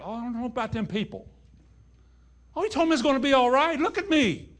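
high, fast, loud mocking whine; high, fast and loud sceptical patient voice; mocking complainer. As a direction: ham up the doubter's complaint. Mimicking doubtful patient gossiping.

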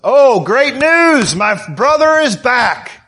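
slow, sing-out mock delight; mock delighted response. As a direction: play the brother overjoyed at the news, hammed up. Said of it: "That's his response".

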